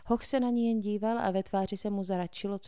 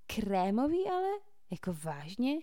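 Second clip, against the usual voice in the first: incredulous; 3 semitones higher than usual. A narrator's reading. Tucker disgusted at offered beer.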